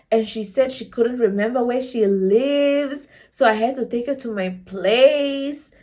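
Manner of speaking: slow, halting excuse with long drawn-out phrase ends; mock-earnest, stretched-out explaining; imitated boyfriend explaining. Boyfriend explaining himself to her mom, mock-earnest and halting. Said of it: "he speaks to my mom and he's like".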